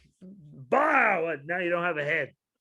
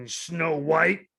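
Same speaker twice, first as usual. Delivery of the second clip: ordinary talk, nothing marked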